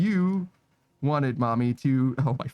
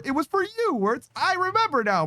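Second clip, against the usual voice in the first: much higher, bright eureka cry; excited realization; 13 semitones higher than usual. Real, not acted. Streamer suddenly remembers who the shout-out is for, excited high voice. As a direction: pitch jumps up as it clicks.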